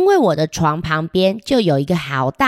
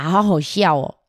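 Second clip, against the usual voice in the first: ordinary delivery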